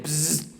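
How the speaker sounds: imitated insect buzz; buzzing noise. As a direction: buzz like a bug in the wall. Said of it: "there it was again".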